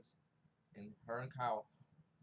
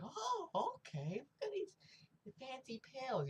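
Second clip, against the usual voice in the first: very high, slow put-on voice; drawn-out, exaggerated high-pitched coo; mock impressed falsetto; 13 semitones higher, slower than usual. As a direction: squeak it out as a mocking imitation. Voicing the maid taking the pills.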